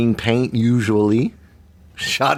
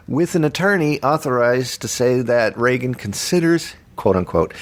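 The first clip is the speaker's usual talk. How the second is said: normal talking voice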